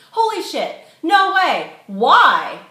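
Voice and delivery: high, loud disbelieving squeal; shocked shriek. A narrator's reading. Girls in the van hear the news, shocked shriek.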